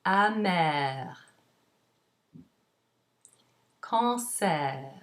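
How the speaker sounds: "amer, cancer, cuillère, enfer, hiver" one by one, endings as open eh plus R; final -er as air. Shown as a demonstration. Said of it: "but as air".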